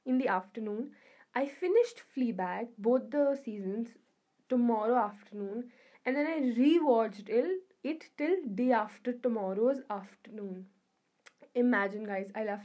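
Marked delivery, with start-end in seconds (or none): none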